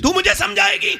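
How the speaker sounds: high-pitched, offended husband's cry; indignant outburst. Acted. Proud chaudhry rebukes the wife who advised him, indignant.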